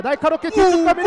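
loud rising play-by-play; excited call. Real, not acted. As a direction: build the tension. Build-up to goal.

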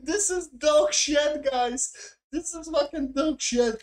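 high, worked-up exclamation after laughing; exasperated outburst. Real, not acted. Reviewer telling the guys how bad the show is, exasperated. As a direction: pitch it right up in disbelief.

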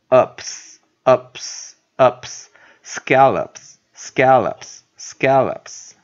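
UPS loud and isolated, then SCALLOPS whole; slow pronunciation demo. Shown as a demonstration. Teacher assembling the word from syllables, slow pronunciation demo. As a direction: model UPS as the light ending, then slowly join it into SCALLOPS. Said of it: "那清母音在O的地方".

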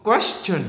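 "Question" with a crisp "ch" in the ending; teacher's model "question"; đuôi âm ch. Shown as a demonstration. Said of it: "Khác biệt đúng không?". ("Question" bật âm "ch" ở đuôi)

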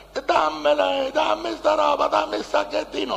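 very high, whining put-on woman; high, nagging imitation; pleading woman imitated. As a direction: beg for it in a mock female wail. Mimics woman chasing him for the bag.

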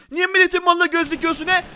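loud, high indignant challenge; hammed-up outrage; mock angry scolding. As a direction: tell off a thief, playing it up. Scolding the thief before attacking.

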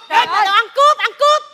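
loud, rising comic tirade; heated marital squabble; angry accusation. Acted. Acted couple trading accusations.

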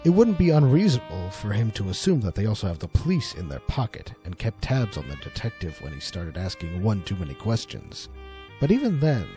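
usual speaking voice

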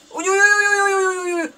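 high, rapid string of alarmed yelps; panicked yelping. Real, not acted. Gamer to himself as an enemy rushes him, panicked yelping. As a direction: yelp in rising panic as danger closes in. Enemy rushing at player in game.

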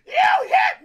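high, loud yelled accusation; mock shout. Mom yelling at her son she got hit. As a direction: shriek it like an outraged mom.

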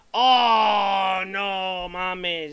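loud, angry outburst; raging gamer. Real, not acted. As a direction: explode at the game. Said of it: "Ya empiezan los cabreos".

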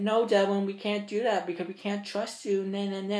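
put-on a teen sneering at group home staff who refused him; mocking staff.

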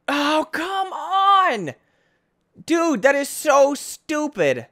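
high, slow, whining complaint; exasperated at the game; frustrated outcry. Real, not acted. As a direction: moan it out in frustration. Frustrated outburst.

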